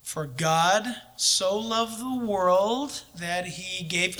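slow, deliberate recitation; slow, careful verse reading; said slowly. Shown as a demonstration. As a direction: draw every word of the verse out slowly. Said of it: "Say it slowly".

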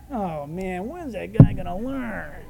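slow, dismayed groaning; exasperated groan. Acted. Angels reacting to Peter's refusal, exasperated. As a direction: drag it out in weary disbelief.

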